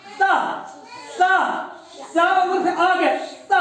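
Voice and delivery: स drawn out slowly, ending in अ. Shown as a demonstration. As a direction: stretch स and let the अ follow. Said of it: "अंत में क्या निकलेगा". (धीरे-धीरे खींचकर स)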